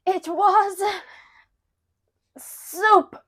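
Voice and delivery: slow, halting mock-tragic reveal; tragic blind son. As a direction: play the wronged victim's grave confession. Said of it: "Ralphie later imagines confronting his parents".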